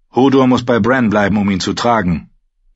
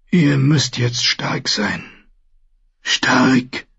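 slow, weak, earnest; dying maester, urgent; 5 semitones higher, much slower than usual. Acted. Dying maester urges Bran, slow and earnest. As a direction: press it on the boy slowly.

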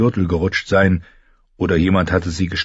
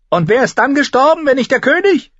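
much higher woman's voice calling down; 4 dB louder than usual. Acted. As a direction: shout down from the window. (hohe Frauenstimme, ruft herab)

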